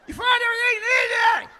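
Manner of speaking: strained shout, repeating herself; repeated shout through glass. Retold or imitated. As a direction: shout it again, straining to be heard. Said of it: "trying to yell through the glass".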